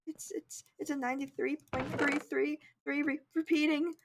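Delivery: high, soft, stammering reply; timid student tripping over the number; mock terrified. Student telling scary teacher her grade, mock terrified. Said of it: "'cause obviously I was terrified of her".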